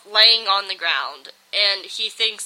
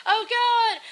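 much higher hammed-up panic; freaking out; 14 semitones higher than usual. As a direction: squeal in mock terror as they transform. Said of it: "they're all freaking out".

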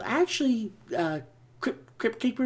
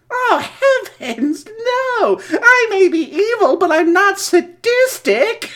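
high, loud laughing ghoul voice; cackling Crypt Keeper; 9 semitones higher, 7 dB louder, slower than usual. Acted. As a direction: cackle through the words wickedly. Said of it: "Crypt Keeper here".

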